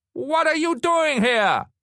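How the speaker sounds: high, loud, drawn-out demand; angry demand. A narrator's reading. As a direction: demand it slowly at full pitch. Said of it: "she demanded".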